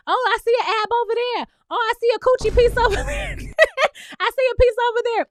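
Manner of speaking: high, fast, excited calling out; mock excited yelling. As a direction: imitate the judge yelling at puzzle pieces. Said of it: "Meg is yelling out".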